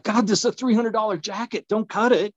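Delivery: high, indignant plea; protesting jacket. Retold or imitated. Telling paramedics not to cut his jacket, high and indignant. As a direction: beg them to spare the jacket. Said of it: "I'm like".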